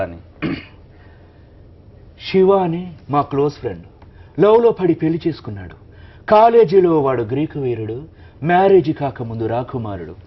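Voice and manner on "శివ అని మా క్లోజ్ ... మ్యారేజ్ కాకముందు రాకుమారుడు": louder, livelier dubbing voice. Retold or imitated. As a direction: tell it as a playful film line. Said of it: "[name] గారే ఆ పాటలో".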